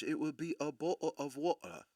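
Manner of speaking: posh English accent, a bottle of water; high English reading of bottle of water. Shown as a demonstration. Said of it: "if I was to do it with high English".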